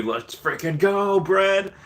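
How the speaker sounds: rising, drawn-out hype call; stretched excited hype; excited cheer. Real, not acted. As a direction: cheer it out, climbing high. Excited birthday hype shout.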